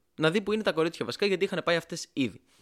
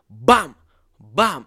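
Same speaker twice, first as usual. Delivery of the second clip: punchy "bam" for each glass downed; two loud, separate vocal slams; loud imitated bang; 2 semitones lower, 8 dB louder than usual. Imitated noise of downing glasses.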